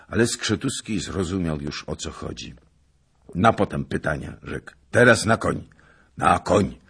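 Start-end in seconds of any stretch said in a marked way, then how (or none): 4.9-6.8 loud order to mount; commanding call. Acted. Skrzetuski orders the troops to mount, loud. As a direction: bark the order to ride out now.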